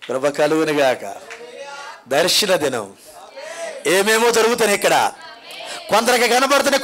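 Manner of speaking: ordinary talk, nothing marked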